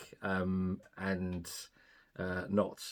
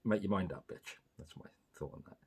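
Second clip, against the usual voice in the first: whispered, much softer, quick; muttered aside; 4 semitones lower, 12 dB quieter than usual. Real, not acted. Host adds a private jab, whispered much softer. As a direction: throw it away under your breath. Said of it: "muttered aside".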